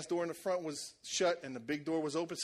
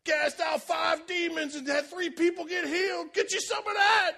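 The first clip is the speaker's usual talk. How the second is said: bragging hype; 9 semitones higher, 5 dB louder than usual. Pretend. Churchgoer bragging at the water cooler, loud and high. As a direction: brag it to the whole break room. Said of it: "by the water cooler and say".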